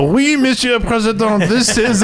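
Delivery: high, slow, hammed-up French accent; mock French accent. Fake French president answering the phone, in a high mock French accent. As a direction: put on a silly high French voice. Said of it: "Do your French accent".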